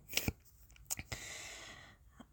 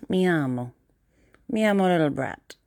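mock-Italian self-introduction; Italian accent. Shown as a demonstration. Playing an Italian introducing themselves, Italian accent.

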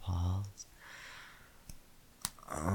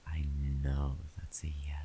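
whispering; 2 semitones lower than usual. Acted. Intimate whispered roleplay.

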